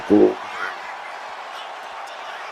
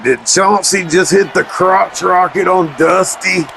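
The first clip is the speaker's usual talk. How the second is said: surprised; 6 semitones higher than usual. Real, not acted. Sees a low blow in match.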